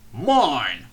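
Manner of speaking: snatch it back crossly. A narrator's reading. Fat controller grabs his top hat, cross and loud. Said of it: "he said crossly".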